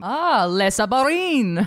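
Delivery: drawn-out, hammed-up French accent; slow, playful put-on French; mock French accent. Mocking the French submarine deal.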